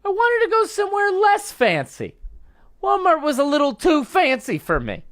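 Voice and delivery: high-pitched mock woman's voice. Comedian quoting an arrested woman, high put-on woman's voice. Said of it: "Said the woman".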